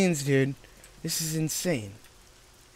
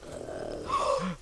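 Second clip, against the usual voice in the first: sharp gasp; 3 semitones higher, 9 dB quieter than usual. Real, not acted. Sudden scare.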